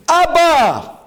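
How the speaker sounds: urgent, high-pitched shout for rescue; acted scream. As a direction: scream out like a son calling his father. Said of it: "be able to scream".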